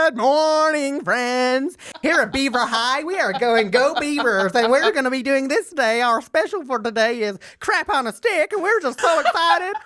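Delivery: high, bright-eyed morning announcements voice; hammed-up school announcer impression; mocking the school's perky morning announcements, high and cheerful. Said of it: "this is how they would start their morning announcements".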